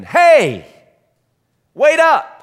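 loud high shout to wait; calling out. Retold or imitated. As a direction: yell after the kid to catch up. Calling after him down hallway.

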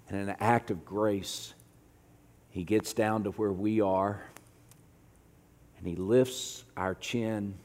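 low, soft voice with long pauses; hushed, tender preaching. Real, not acted. Preacher comforting the congregation with God's love, hushed and tender. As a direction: let each phrase settle quietly.

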